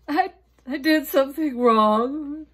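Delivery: loud, high, slow sobbing confession. Acted. Maria confessing to her mother, sobbing. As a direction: stammer it through sobs. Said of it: "Maria sobbed".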